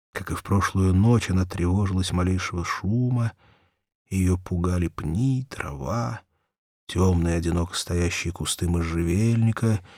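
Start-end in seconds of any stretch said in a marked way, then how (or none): none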